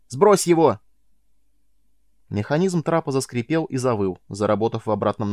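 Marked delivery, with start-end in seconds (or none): 0.1-0.8 high loud shout; yelling. A narrator's reading. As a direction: bark the order. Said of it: "заорал в ответ Майлз".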